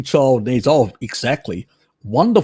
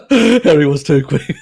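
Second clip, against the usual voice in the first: normal talking voice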